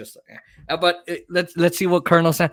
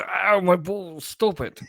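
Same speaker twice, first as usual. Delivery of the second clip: mock pain; 5 semitones higher, slower than usual. Pretend pain gag.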